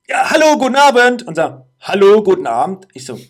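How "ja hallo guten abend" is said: high, loud yell of a greeting; retold yell. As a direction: shout it up at the intercom so they hear you. Ruft in die Sprechanlage. (laut nachgespielter Ruf)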